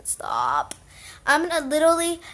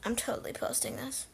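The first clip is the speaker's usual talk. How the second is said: whispered tease; 12 dB quieter than usual. Real, not acted. Teasing sister after her fall.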